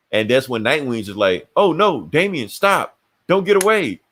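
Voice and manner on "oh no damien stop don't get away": high, hammed-up mock plea to stop; feigned alarm. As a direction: badly fake being alarmed at an escape. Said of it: "he winks at him".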